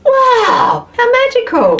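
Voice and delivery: high-pitched, awestruck delight; gushing wonder. Real, not acted. Vlogger marvels at her iced tea, gushing wonder. Gushing over a first sip.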